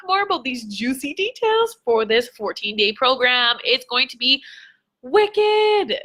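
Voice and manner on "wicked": high-pitched, drawn-out burst of excitement; excited exclamation. Real, not acted. Coach hyping her fourteen-day program to followers, excited. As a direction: hype it up with a high squeal.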